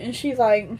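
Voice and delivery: usual speaking voice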